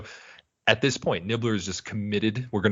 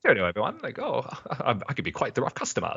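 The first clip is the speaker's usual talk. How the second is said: put-on lightly done posh English accent, quick at the end; a hint of a toff saying cheerio; old English accent; 5 semitones higher, faster than usual. Bender's disguise, a light posh English accent. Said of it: "old, proper English type of pronunciation".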